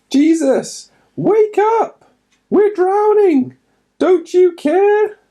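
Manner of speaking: high, loud frantic cry for help; panicked shout. Acted. Disciples crying out to sleeping Jesus in the storm, panicked shout. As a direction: scream it over the storm in terror.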